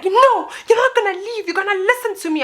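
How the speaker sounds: high-pitched insistent wail; mock tearful woman. Crying woman grabs bag, demands attention.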